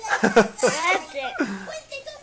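high-pitched laugh; amused laugh. Real, not acted. Amused by child's English answer.

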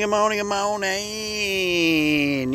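high, stretched-out singsong hello, slowing to a crawl on the last one; drawn-out morning greeting. Acted. Host greeting viewers at the show's start, high and drawn out. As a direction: sing out good morning to the viewers like a cheery show host.

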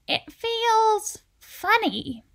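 high, very slow, drawn-out character voice; slow, wondering storybook voice; puzzled Anger. A narrator's reading. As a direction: stretch it out, trying slowness for the first time. Anger slowed by calm walking.

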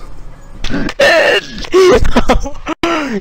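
long high-pitched laugh; real burst of high laughter. Real, not acted. Friend cracks up at an absurd confession, high laughter.

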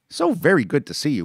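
high, light widow's voice; Widow Tucker voice. Acted. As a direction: greet the boy gently as the widow. Widow Tucker greets boy.

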